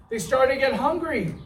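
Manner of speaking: normal talking voice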